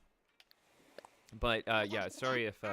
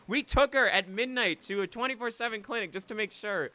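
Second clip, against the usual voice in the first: emphatic; 8 semitones higher, 6 dB louder than usual. Real, not acted. Emphatic retelling of urgency.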